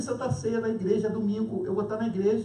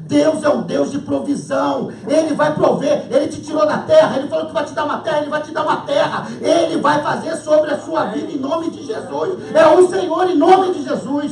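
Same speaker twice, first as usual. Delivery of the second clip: full-force preaching; 7 semitones higher, 10 dB louder than usual. Acted. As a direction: preach it out to the whole church.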